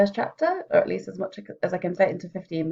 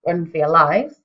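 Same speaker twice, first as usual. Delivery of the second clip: a lightly louder guard's cry of warning; mock yell; 8 dB louder than usual. Guard posted at the door warning the town, mild mock yell. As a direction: call it out half-heartedly, like a bored guard. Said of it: "spend ten minutes yelling".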